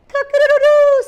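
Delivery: very high, loud crowing; rooster crow. A narrator's reading. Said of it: "said the rooster".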